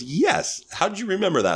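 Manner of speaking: high-pitched, astonished imitation; amazed. Mom to the man who remembered her, high amazement. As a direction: act out a delighted, disbelieving mom.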